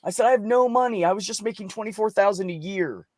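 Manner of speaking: high, strained, pleading voice; upset pleading. Retold or imitated. Retelling to therapist, pleading she can't pay. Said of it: "and I'm upset because I said".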